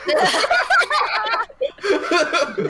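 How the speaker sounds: high laugh, slow words through it; cracking up mid-line. Real, not acted. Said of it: "DM laughs at attack".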